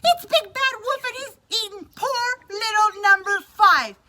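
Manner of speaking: loud, high accusing duck voice; high angry cry. Acted. Missus Duck accusing the wolf, high angry cry. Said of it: "she cried".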